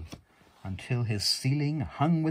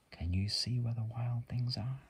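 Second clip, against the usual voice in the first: whisper; 2 semitones higher, 5 dB quieter than usual. Real, not acted. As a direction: whisper it gently over the book.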